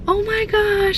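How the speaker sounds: high excited gasp. Retold or imitated. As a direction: squeal it like she's thrilled. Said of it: "she was really excited".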